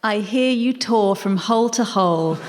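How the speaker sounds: ordinary talk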